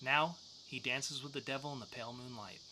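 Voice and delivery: ordinary delivery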